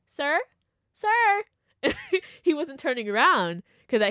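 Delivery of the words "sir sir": each sir higher and sharper; rising pointed call; escalating call. Retold or imitated. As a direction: keep calling until he turns round. Calling him, no answer.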